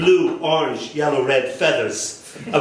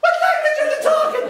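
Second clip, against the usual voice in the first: put-on much higher, very fast Cork accent; faster than usual. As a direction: rattle it off like a Cork local. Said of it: "people talking like this".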